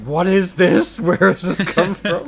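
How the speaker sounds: incredulous, laughing through it; baffled and amused; laughing disbelief. Retold or imitated. Voicing baffled reaction online.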